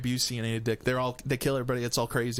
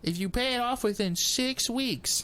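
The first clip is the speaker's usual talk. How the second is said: high, slow sales patter; smooth it over like a dealer; slick used car salesman; 9 semitones higher, slower than usual. Retold or imitated. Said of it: "used car salesman".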